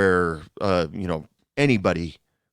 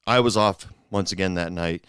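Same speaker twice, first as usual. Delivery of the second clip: ordinary talk, nothing marked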